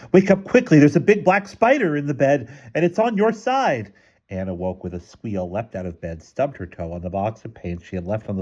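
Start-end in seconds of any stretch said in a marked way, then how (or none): 0.1-3.9 high, loud urgent alarm; alarmed child's warning, pitched way up; scary, urgent. A narrator's reading. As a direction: scare them awake with a high-pitched warning. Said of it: "making her voice scary and urgent".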